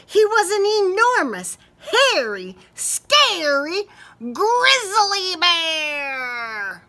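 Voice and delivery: loud, slow, growly-sized emphasis; drawn-out monster reveal; big scary bear. A narrator's reading. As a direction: make the bear enormous and scary. Narrator dramatizes huge scary bear.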